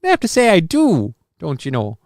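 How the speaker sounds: mock Minnesotan with rounded vowels; Minnesotan accent. Host echoing co-host's slip, mock Minnesotan accent. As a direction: tease with a folksy Minnesotan accent. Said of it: "a little bit of Minnesotan popped".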